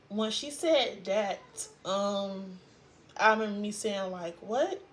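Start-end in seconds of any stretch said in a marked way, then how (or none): 4.4-4.8 higher, questioning "what"; retold disbelief. Daughter just told her daddy was killed, disbelieving question. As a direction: react as if it can't be true. Retold reaction to father's death.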